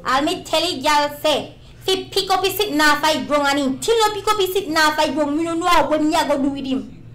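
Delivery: loud, high rural Jamaican patois; country Jamaican. Acted. Country Jamaican accent, bragging about a man to a friend. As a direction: belt it out like a country Jamaican yardie. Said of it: "So I do the country Jamaican".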